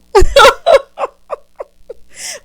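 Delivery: loud high laughing fit; real long cackle. Real, not acted. Teasing Karen with own line, loud high cackle.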